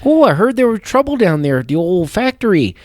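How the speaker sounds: chatty small-town gossip in a Fargo voice; gossiping neighbor in a mock Fargo accent. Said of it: "Little Fargo".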